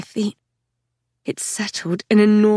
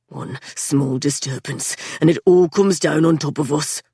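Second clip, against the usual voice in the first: rasping; 4 semitones lower than usual. A narrator's reading. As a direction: rasp it out, worn out. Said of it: "he rasped".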